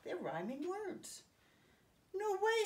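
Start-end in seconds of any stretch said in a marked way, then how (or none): 2.1-2.6 put-on higher and louder, a kid's eager no way; bright storybook kid protesting; excited protest. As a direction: have Rick burst out with no way. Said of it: "said Rick".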